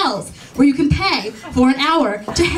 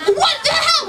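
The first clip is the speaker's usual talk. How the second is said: much higher, incredulous cry; exasperated outburst; 12 semitones higher than usual. Retold or imitated. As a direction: explode in disbelief. Reacting to coat misunderstanding.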